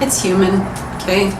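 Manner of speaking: normal talking voice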